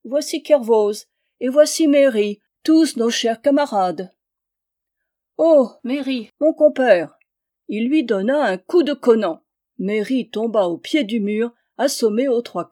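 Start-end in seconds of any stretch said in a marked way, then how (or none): 0.0-7.2 high, loud hearty monk; mock-delighted boisterous welcome; jovial fighting monk, greeting. A narrator's reading. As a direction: hail old pals as you fight them. Bruno greets foes as old friends.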